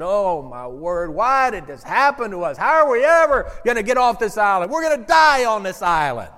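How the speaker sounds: shipwrecked man, hammed-up frantic panic. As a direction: pace and panic about dying on the island. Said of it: "he's frantic".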